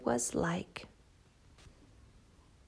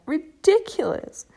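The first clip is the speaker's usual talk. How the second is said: mocking; 7 semitones higher, 6 dB louder than usual. Cat mocks tortoise.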